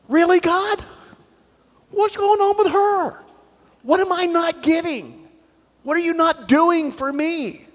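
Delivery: a jealous churchgoer whining to God. Acted. Churchgoer complaining to God, high and whiny.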